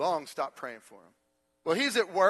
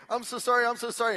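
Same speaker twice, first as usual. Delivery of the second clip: high, hurried pleading without real tears; imitating a weeping wife's repeated apology; mock tearful apology; 6 semitones higher, 4 dB louder, faster than usual. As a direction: plead it in a raised voice. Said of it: "she's crying, she's weeping".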